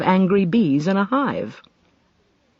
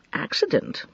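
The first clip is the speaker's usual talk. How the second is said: questioning whisper; 6 semitones higher, 6 dB quieter than usual. Acted. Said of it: "she repeated".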